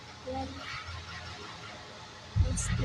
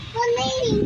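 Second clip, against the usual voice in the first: usual speaking voice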